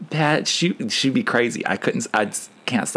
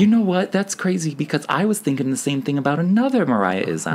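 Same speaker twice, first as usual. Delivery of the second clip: excited; 7 semitones higher than usual. Real, not acted. Excited reply.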